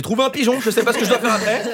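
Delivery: high imitation of a clueless caller; flustered pollster. Pollster telling her supervisor about a catch, high and flustered. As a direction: report in a high, mock-flustered voice.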